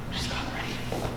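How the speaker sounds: hushed aside; whisper. Acted. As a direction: whisper it behind your hand. Whispered aside about departed partner.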